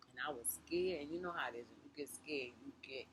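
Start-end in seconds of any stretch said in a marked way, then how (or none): none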